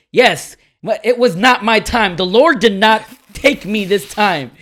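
loud, high, drawn-out triumphant boast; gloating. Retold or imitated. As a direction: crow it like a victorious preacher. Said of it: "she's gloating".

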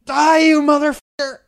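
loud, raging shout; furious shout. Retold or imitated. Knifeman yelling at the congressman, full-volume shout. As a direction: scream it as you lunge. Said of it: "before going".

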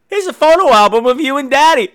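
high, singsong daughter voice; high-pitched gift-giving daughter; sweet daughter. Acted. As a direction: present it brightly in a high voice. Voices daughter showing gifts.

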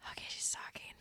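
hushed unvoiced whisper; whispering while the AI answers. Real, not acted. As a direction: breathe it so the AI can't hear. AI starts generating answer.

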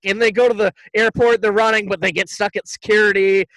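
ordinary talk

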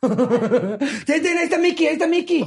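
high-pitched squeals of mock delight; mock excited cheering. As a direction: cheer like a thrilled kid spotting an idol. Acting out delight at seeing Mickey.

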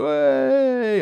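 high mock whoop; mock cheer. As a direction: imitate the crowd's cheer. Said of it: "I was like".

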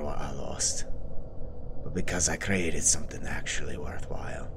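low, weary, whispered Scottish lord. Acted. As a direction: confess it quietly in Scottish brogue. Villain's weary confession.

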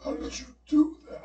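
high, strained put-on voice; mock dying gunman. Dying gunman to the dreamer who shot him, mock-feeble high voice. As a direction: squeak it out as the beaten villain.

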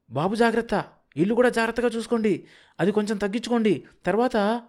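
high, fast parting call from a moving car. A narrator's reading. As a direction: call out the last instructions as the car pulls away.

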